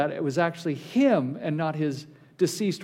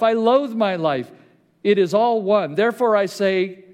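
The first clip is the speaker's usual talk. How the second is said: rising, louder preaching read; preaching scripture; 3 semitones higher, 4 dB louder than usual. Acted. Pastor reads Job's protest to the church, forceful.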